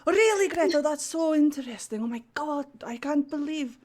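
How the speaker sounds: overdone amazed chatter; feigned fascination. Acted. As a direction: gush to keep her distracted. Feigned interest while stealing.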